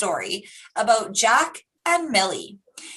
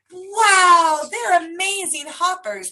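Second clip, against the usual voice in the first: loud higher wow of wonder; amazed; 8 semitones higher, 3 dB louder than usual. Real, not acted. Teacher marvelling at hopping frogs for children, excited wow.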